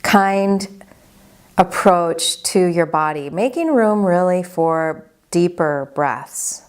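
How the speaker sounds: unhurried, even-toned body scan guidance; guided meditation. Acted. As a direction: guide them gently into calm, leaving space after each phrase.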